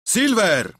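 loud high shout of the name; crowd shout. Acted. Pirates shout for Silver, loud and high. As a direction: yell it as a crew. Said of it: "krzyknęli wszyscy".

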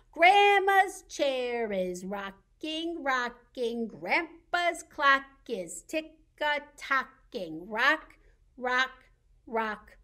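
slow chant, each beat set apart; rhythmic nursery rhyme. Pretend. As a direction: chant it steadily like a rocking chair and clock. Chants the lap rhyme again.

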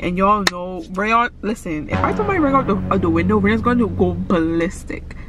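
ordinary talk, nothing marked

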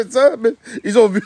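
high, laughing delivery. Real, not acted. Joking about beating them at their game, laughing. Laughs through his own words.

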